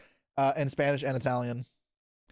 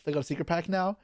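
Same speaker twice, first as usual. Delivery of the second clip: normal talking voice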